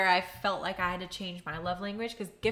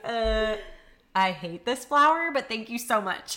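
mock grudging thanks; 3 semitones higher, 6 dB louder than usual. Imitating boyfriend thanking for hated flower, loud mock thanks. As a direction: fake gratitude over dislike.

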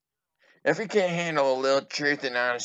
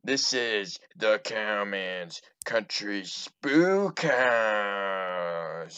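spooky ride narrator; 5 semitones lower, much slower than usual. Acted. Ride host announcement.

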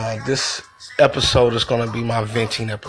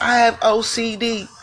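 mocking her claim; 9 semitones higher than usual. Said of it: "she's like".

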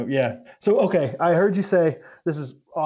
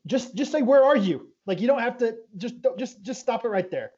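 higher, exasperated "Where are you?" correction; exasperated wife; 8 semitones higher than usual. Retold or imitated. Wife scolding someone who says "where are you at", exasperated and higher. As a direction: snap at them to drop the "at", pitched up. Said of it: "It's like".

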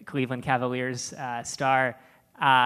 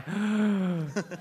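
sharp shocked intake of breath; imitated audience gasp; 6 semitones higher than usual. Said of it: "They make a sound like".

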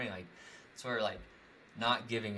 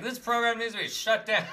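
laughing; 11 semitones higher, 7 dB louder than usual. Retold or imitated. Retelling his bold prediction.

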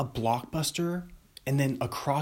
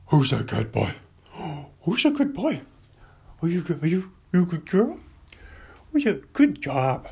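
loud, excited pet-talk voice; talking to a dog; 5 semitones higher, 4 dB louder than usual. Acted. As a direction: praise the good boy, gushing.

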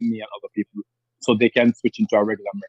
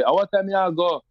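rural Jamaican patois, pitched higher; 5 semitones higher than usual. Shown as a demonstration. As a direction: rougher country Jamaican patois, raised. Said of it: "someone from say".

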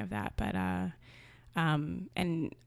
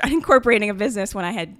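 louder, fast, with a laugh breaking in mid-line; laughing through it; 5 semitones higher, 12 dB louder, much faster than usual. Real, not acted. Recalling doing the same research years ago, laughing aloud. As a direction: let a real laugh burst out as you speak.

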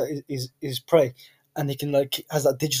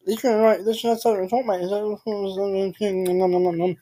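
higher, munching Australian caricature; mock Australian accent; 4 semitones higher than usual. Co-host mocking Australians as carrot-chewers, munched accent. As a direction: garble Aussie sounds as if chewing carrots. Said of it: "Like the chicken carrot".

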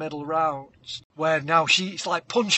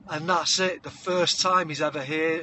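ordinary delivery

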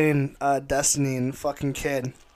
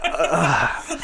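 mock groan. Shown as a demonstration. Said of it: "I just do that".

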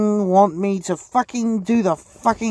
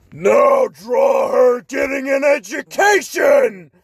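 higher, slow demanding shout; acted shout; 6 semitones higher, 5 dB louder, slower than usual. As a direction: yell the order at the artist. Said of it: "Okay, um".

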